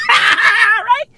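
ordinary talk

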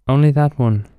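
loud, high answer from tree; lightly voiced fir tree.

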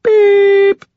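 high electronic beep. A narrator's reading. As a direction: chirp like a machine tone. Voicemail beep.

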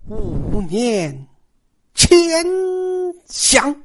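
word by word with long pauses; slow, teasing build to 前想; drawn-out emphasis. A narrator's reading. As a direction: stretch each piece, leaping high on 前. Stretches out advice for comic effect.

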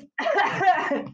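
a laughing cough fit; spluttering cough with a laugh in it; choking cough. Real, not acted. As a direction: cough as if choked mid-laugh. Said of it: "me engasguei".

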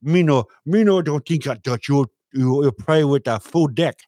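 lightly voiced mock accent; mock Chinese accent. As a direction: object in a put-on accent. Accent voice interrupts Trump.

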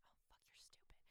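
hushed, whispered exasperation; whispered aside. Retold or imitated. Heroine reacting to his clueless answer, whispering. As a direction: whisper it as a thought to yourself.

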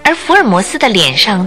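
ordinary talk

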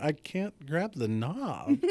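retold pained spelling-out of the obvious; exasperated. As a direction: space out every word in exasperation. Exasperated explanation to wife.

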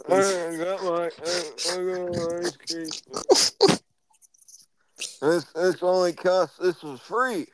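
hammed-up Biden boasting about free ice cream; Joe Biden voice. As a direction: play a pleased old man with ice cream. Voicing Biden.

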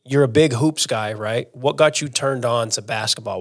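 ordinary talk

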